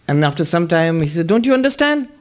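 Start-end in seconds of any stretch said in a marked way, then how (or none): 1.3-2.1 high exasperated question; high-pitched old swami. Retold or imitated. Old swami to silent novices, high exasperated question. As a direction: squeak out the challenge.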